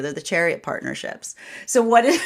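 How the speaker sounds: ordinary delivery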